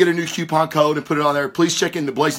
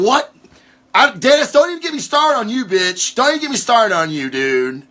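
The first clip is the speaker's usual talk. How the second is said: higher-pitched, quick playful scolding; mock outrage; 7 semitones higher than usual. Real, not acted. Podcaster teasing Dennis in the chat, mock outrage. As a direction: tell him off in high-pitched mock indignation.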